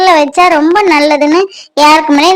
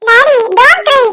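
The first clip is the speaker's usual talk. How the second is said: put-on high, childlike chatter of a small daughter; little girl voice; 9 semitones higher than usual. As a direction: talk like a sweet little girl telling daddy secrets. Child comforts crying father.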